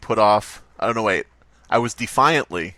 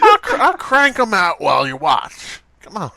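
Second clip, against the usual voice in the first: much higher, louder hammed-up hawker; mock stand seller; 8 semitones higher, 5 dB louder than usual. Playing the kid at the milkshake stand, hammed-up hawker. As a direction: hawk milkshakes like a carnival barker.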